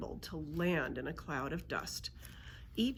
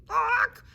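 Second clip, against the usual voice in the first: sudden loud, high squawk; griffin squawk; 12 dB louder than usual. A narrator's reading. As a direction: let out a piercing bird squawk. Griffin squawks after crashing.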